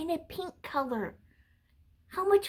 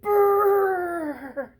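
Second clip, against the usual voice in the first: cold shiver; 4 semitones higher, 7 dB louder than usual. Acted. Penguins live where it's cold.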